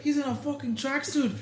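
high, loud mock-Irish jeer; Conor McGregor. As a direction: imitate an incredulous Irish trash talker. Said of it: "his accent".

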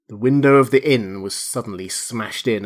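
usual speaking voice

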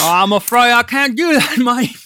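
high, loud Cockney computer voice; Cockney accent. Retold or imitated. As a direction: play Hal as a thick Cockney. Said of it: "really thick Cockney accent".

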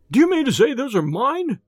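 patient pointing at footprints, crying out high; frightened cry. Acted. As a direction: cry it out in disbelief. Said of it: "he cried".

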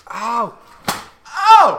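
sharp rising cry of pain; pained yelp. Real, not acted. Just hurt his ankle.

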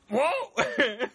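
high mock yelp, laughter close behind; Godzilla. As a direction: imitate the crashing monster's startled whoop. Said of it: "his, his sound, he just".

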